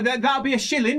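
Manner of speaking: name a price as a small pie seller; halfling merchant. Acted. Halfling sizing up a customer, high sales pitch. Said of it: "he says".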